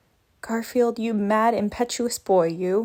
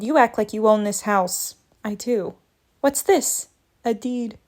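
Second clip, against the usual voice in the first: usual speaking voice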